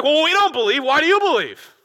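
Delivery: very loud, high-pitched scoffing; hammed-up indignant holler at lesser minds; condescending mock protest. Pharisees challenging soldiers who believe, loudly condescending. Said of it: "this condescending attitude".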